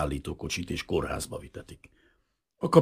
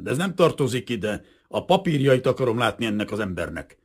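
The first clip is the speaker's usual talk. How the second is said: loud, raised-pitch official demand; haughty insistence; 6 semitones higher, 7 dB louder than usual. A narrator's reading. Officer demands to see papers, haughty. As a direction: insist with an air of superiority. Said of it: "recsegte fölényesen".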